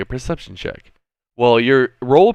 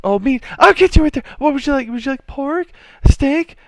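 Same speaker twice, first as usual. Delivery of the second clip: high, eager-to-please falsetto; frightened barmaid; 4 dB louder, faster than usual. Acted. Barmaid offering meat to an intimidating customer, flustered. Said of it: "And the barmaid's".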